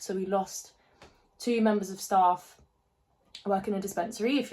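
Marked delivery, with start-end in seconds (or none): none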